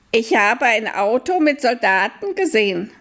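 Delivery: high owl voice, calm; a high, patient old owl; wise owl. Acted. Owl reports soldiers. (hohe, ruhige Eulenstimme)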